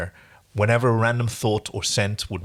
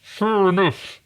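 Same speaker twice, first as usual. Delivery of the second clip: choked, strangled and higher; 8 semitones higher, much slower than usual. Acted. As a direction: squeeze it out like you're being strangled. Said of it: "sounds like the person who is about to be murdered".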